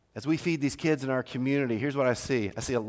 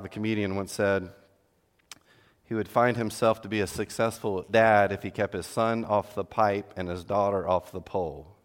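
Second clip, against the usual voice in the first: normal talking voice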